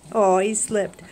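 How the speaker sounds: normal talking voice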